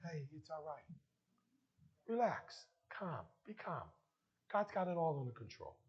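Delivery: soft, low and soothing; comforting. Acted. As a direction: hush someone gently, pausing between phrases. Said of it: "in a very comforting".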